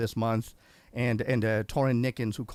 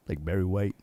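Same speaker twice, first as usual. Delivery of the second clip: lowered dark speaking voice; dark, husky low tones; 7 semitones lower than usual. Retold or imitated. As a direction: drop low for a deep soul-man impression. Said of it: "he's got that speaking voice".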